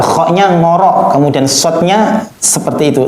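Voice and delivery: ordinary delivery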